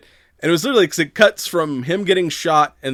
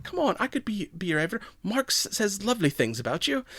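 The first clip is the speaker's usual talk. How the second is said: put-on bubbly Valley Girl; 8 semitones higher, 5 dB quieter than usual. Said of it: "it's like".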